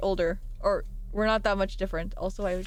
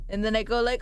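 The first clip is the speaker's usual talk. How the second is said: usual speaking voice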